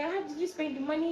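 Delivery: high-pitched retold indignation; pissed-off dad. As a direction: imitate an angry father's demand. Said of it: "he was so pissed".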